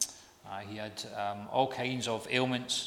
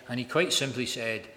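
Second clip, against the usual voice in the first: ordinary talk, nothing marked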